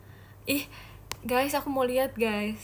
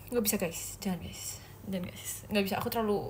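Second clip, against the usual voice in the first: soft, lower-pitched pleading; embarrassed plea; 3 semitones lower, 8 dB quieter, much faster than usual. Real, not acted. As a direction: shrink back and plead quietly. Embarrassed plea not to clip. (memohon pelan karena malu)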